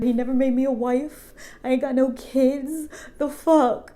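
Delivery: high, wailing comic lament; mock crying. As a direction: wail it like a hammed-up grieving monster. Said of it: "the monster starts crying".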